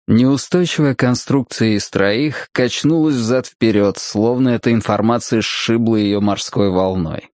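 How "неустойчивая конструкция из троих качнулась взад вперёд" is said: ordinary delivery, nothing marked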